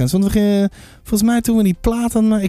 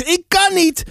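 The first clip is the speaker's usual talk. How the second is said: high-pitched mock yell; narrated roar; 8 semitones higher than usual. A narrator's reading. Sam to brother before the wave hits, acted roar. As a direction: cry out high, volume held back. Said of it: "brulde Sam terug".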